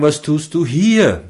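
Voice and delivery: „hier“ weighted at the end, slowly; slow question that falls on „hier“; stress on HIER. Shown as a demonstration. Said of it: "Damit zur vierten Betonung".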